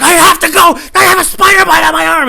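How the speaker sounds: high, panicked full-out yell; flipped-out shout. Retold or imitated. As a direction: freak out and shout at the boss. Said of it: "I flipped out on my boss".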